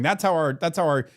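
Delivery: ordinary delivery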